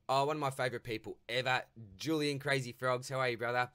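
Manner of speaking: light up for a favourite fan; beaming loud hello; delighted welcome. Real, not acted. Greeting a favourite listener.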